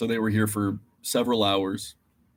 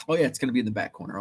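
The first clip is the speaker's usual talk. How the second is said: rushed clerk; much faster than usual. Retold or imitated. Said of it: "Asked the guy at the front".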